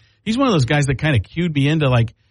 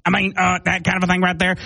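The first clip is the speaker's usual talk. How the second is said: higher goosey Texas twang; Patrick Mahomes; 5 semitones higher than usual. Retold or imitated. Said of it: "it becomes Patrick Mahomes".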